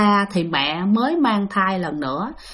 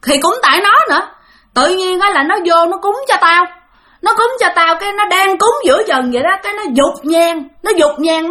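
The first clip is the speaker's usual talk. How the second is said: put-on a vengeful old ghost venting its grudge, high and heated; fierce ghost voice; 7 semitones higher than usual. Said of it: "mới nãy rất là dữ".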